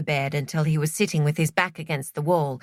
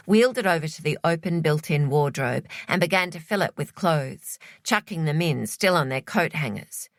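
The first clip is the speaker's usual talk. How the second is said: plain reading voice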